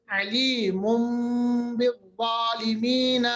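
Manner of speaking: علیم بالظالمین slowly, tanween as m before ba. Shown as a demonstration. Teacher slowly models the iqlab in علیم بالظالمین. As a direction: stretch علیم بالظالمین, letting the tanween become m. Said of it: "مگر ہم پڑھتے ہیں".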